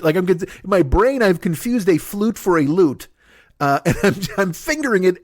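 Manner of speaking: usual speaking voice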